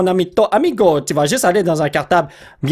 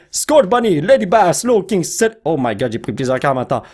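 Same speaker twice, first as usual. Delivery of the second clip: normal talking voice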